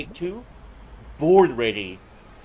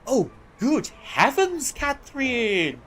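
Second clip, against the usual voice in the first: high, slow, hammed-up aristocrat voice; overacted posh period drama; 6 semitones higher, 9 dB louder, slower than usual. Friends greeting at a chance encounter, hammed-up posh voice. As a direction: ham it up like a stuffy noble. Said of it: "I'm just overacting this on purpose".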